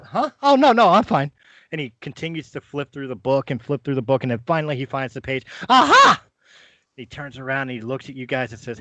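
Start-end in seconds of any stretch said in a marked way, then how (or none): 0.0-1.3 high, loud fluster; an old wizard embarrassed while fumbling his book; Corbin flustered. Acted. Corbin flustered, refusing help. 5.7-6.2 high, loud eureka; an old wizard finally finding the right page; triumphant aha. Acted. Corbin finds the page.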